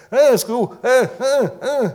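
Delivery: very high, loud mock baby squalls; imitated baby crying out. Preacher mimicking what the unweaned cry for, shrill mock wailing. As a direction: cry out in short, demanding baby bursts. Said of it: "så roper de ikke etter".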